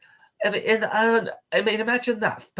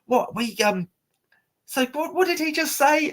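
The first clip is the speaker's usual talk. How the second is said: mock shocked presenter. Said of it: "they said".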